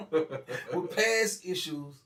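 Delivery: slow, loud, laughing through it; amused repeat, laughing. Real, not acted. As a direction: laugh out the words.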